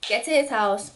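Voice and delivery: ordinary talk, nothing marked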